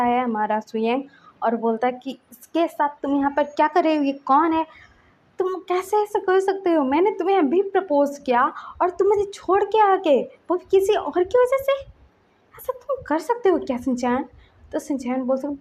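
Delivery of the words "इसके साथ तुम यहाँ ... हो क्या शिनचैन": raised, higher-pitched accusing questions; hurt anger. A narrator's reading. Suyeng confronts Shinchan for leaving him, angrily hurt. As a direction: confront him, indignant and wounded. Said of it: "उसको गुस्सा भी आ रहा था".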